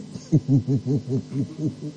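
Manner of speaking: soft low chuckle in a rapid string. Real, not acted. Speaker amused by own remark about church, soft low chuckle. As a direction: chuckle quietly, low and rippling.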